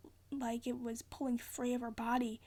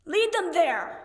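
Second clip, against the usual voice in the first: loud urgent shout, higher; 6 semitones higher, 8 dB louder, slower than usual. A narrator's reading. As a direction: shout an order from below. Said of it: "Percy shouted".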